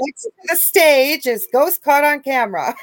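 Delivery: giggling host intro; laughing announcement. Real, not acted. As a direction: announce loud through laughter. Announcing guest to stage.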